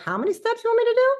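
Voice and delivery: very high, loud, rushed complaint; hammed-up high-pitched disbelief; mock exasperation. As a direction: squeal it in mock protest at all the work. Retold exasperation at skincare steps.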